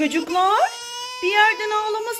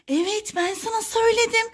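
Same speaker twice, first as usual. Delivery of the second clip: ordinary talk, nothing marked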